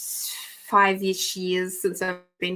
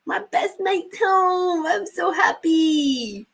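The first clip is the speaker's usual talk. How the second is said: much higher, slow, squeaky puppy voice; the dog cheering that Dad is home, in a much higher voice; voicing the dog; 11 semitones higher, much slower than usual. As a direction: speak for the overjoyed chihuahua. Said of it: "He's like".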